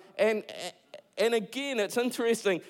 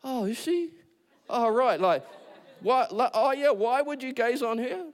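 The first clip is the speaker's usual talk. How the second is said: high, bewildered mock dimwit; husband caught off guard, playing dumb; clueless husband; 7 semitones higher than usual. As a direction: play the clueless guy who just doesn't get it. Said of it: "And he's like".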